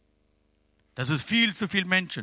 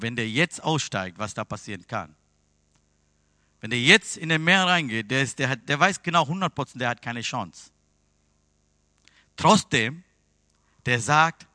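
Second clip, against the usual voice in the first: normal talking voice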